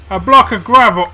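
loud, disbelieving outburst; surprised exclamation. Real, not acted. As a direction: blurt it out in shock. Surprise at crafting result.

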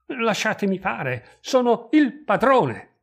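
high, loud, drawn-out defiance; angry outburst rising in pitch and volume; enraged rebellion. A narrator's reading. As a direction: assert your authority furiously, words stretched out. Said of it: "Ghirlando imbestialito si ribellò".

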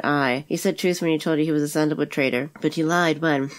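ordinary delivery

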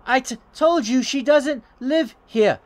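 high, stammering put-on woman's voice; flustered old tenant. Tenant insists to caller she doesn't live here, flustered high voice.

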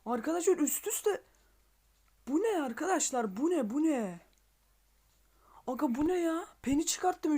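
high-pitched, breathless disbelief at a lucky unlock; real excitement. Real, not acted. Gamer unboxing a rare character for his viewers, overexcited and high. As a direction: squeal out the shock of the free box. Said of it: "heyecandan ne diyeceğimi bilmiyorum".

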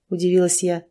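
ordinary talk, nothing marked

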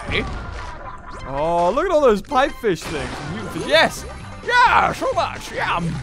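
usual speaking voice

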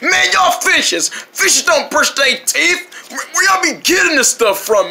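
high, slow, drawn-out scoffing; brash, teasing fish. Acted. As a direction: ridicule them in a high, incredulous voice. Jerry mocks the other fish.

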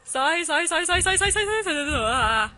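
loud, high, repeated in panic; panicked yelling. Real, not acted. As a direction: shout it over and over in fright. Panic as ghost appears.

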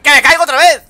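grito de susto. Real, not acted. Se vuelve a caer.

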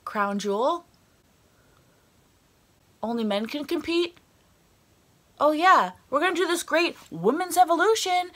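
a hint of a cheesy promo voice; mocking the company. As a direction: mimic them gushing, dripping with sarcasm. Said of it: "and you were like".

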